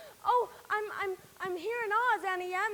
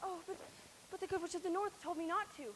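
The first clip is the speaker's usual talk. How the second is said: much softer, hesitant; timid refusal; 3 semitones lower, 12 dB quieter than usual. Acted. Dorothy timidly refusing the witch, very soft. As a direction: barely dare to say it.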